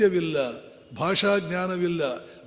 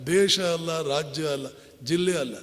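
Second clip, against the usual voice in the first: normal talking voice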